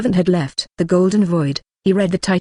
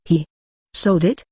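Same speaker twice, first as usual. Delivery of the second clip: ordinary delivery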